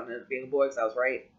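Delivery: ordinary talk, nothing marked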